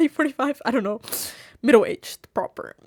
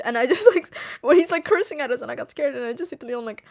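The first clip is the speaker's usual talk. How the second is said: ordinary talk, nothing marked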